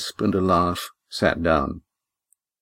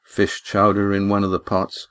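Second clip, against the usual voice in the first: ordinary delivery, nothing marked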